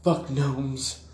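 put-on grumpy old man. Dying old monk's last words.

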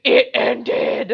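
loud, put-on little kid voice; loud hammed-up child's retort; mock kid outburst. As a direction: blurt it out as a bratty kid impression. Retelling little kid's outburst.

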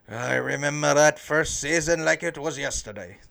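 put-on a light old-timer voice, reminiscing; like an old man on a stump telling his kids; hint of a grandpa telling tales; old guy accent. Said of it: "I have to have an accent when I say it".

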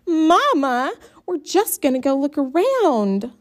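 high whining plea. A narrator's reading. Brenda whining to Mama, high and pleading. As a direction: whine sweetly at Mama. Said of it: "stop whining so".

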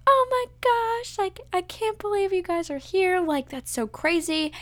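very high, stretched-out squeal; retold amazed squeal. Newly engaged woman turning to those there for her, amazed squeal. Reacting to people there for proposal.

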